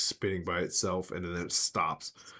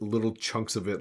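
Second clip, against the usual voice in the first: ordinary talk, nothing marked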